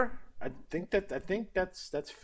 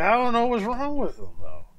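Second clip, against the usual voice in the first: higher mock old-timer voice; Terry Funk again; 12 semitones higher, 4 dB louder than usual. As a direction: lightly imitate the rambling veteran. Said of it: "It's like".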